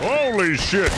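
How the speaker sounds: sudden high, loud cry of shock; shocked shout of alarm; startled outcry. Acted. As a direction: yell it the instant the bear hits. Bear attack, startled outcry.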